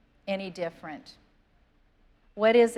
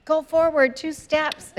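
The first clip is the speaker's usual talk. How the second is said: sister's instruction; 7 semitones higher, 6 dB louder, slower than usual. Retold or imitated. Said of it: "your sister's saying".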